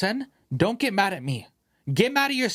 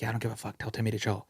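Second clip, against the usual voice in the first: softer, lower blunt reply; hushed retort; 6 semitones lower, 7 dB quieter, faster than usual. Retold or imitated. Answering the mother during the movie, hushed retort. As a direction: snap back quietly in the theater. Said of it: "It's like".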